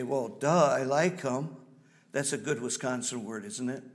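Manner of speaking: ordinary delivery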